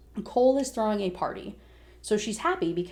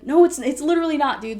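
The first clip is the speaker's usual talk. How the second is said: high, loud retold objection; exasperated protest; 6 semitones higher, 7 dB louder than usual. Girlfriend arguing with secret boyfriend, exasperated. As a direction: push back hard, acting out exasperation.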